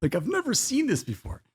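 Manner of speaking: high, drawn-out bewildered inner voice; mock puzzled self. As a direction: play your younger self baffled by a stranger. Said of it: "And I was like".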